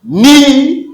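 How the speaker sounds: high, loud, drawn-out "Ni"; syllable drill. Shown as a demonstration. Teacher says repeat after me, high loud syllable "Ni". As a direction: hold "Ni" up high for repetition. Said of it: "Après moi".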